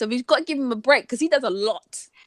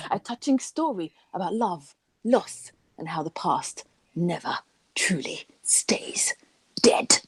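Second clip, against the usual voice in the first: near-whispered spooky tagline; dramatic blurb reading; 4 semitones lower, 3 dB quieter than usual. Acted. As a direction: draw it out softly, pausing before the last word.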